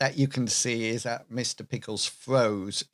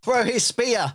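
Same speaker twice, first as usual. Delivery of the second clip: excited call; 10 semitones higher, 4 dB louder than usual. Real, not acted. Player shouting excited suggestion.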